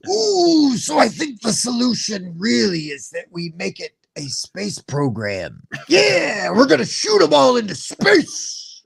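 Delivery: high, hammed-up Macho Man growl; pro wrestler voice. Showing the podcast host how to play the CEO as a wrestler, hammed-up Macho Man growl. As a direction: roar it like a wrestler cutting a promo. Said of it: "as if you were a professional wrestler".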